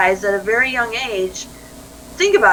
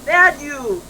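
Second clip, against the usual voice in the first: mock scolding adult; 5 semitones higher, much slower than usual. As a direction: tell off a toddler in mock disapproval.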